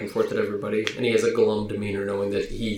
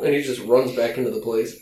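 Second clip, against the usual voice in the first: normal talking voice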